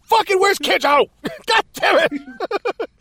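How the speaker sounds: high, loud, hammed-up raging search; mock furious rant. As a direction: shout at one suspect after another, building to exasperation. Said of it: "He's so fucking mad".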